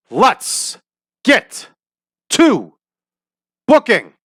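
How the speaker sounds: one word at a time, each punched high; emphatic kickoff. Real, not acted. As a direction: hype it with a big pause after every word.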